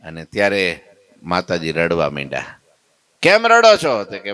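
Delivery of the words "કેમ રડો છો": high, loud surprised ask; startled, asking why she weeps; asking the crying mother why she weeps, high and concerned; concerned question. Retold or imitated. Asking a weeping woman.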